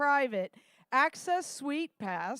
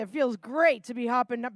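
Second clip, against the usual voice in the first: ordinary talk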